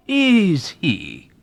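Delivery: high, loud mock surprise; old man teasing the young officer. A narrator's reading. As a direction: tease him with fake concern. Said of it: "teased the unregenerate old man".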